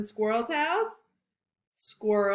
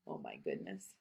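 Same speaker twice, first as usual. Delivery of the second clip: much lower, soft dismay; low hushed aside; 16 dB quieter than usual. Real, not acted. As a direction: gasp quietly at the mess. Reader's aside.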